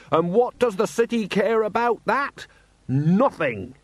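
high, slow indignant shout; shouting merchant. A narrator's reading. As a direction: shout your grievance across the square. Jenkins rants on.

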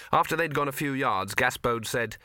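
ordinary delivery

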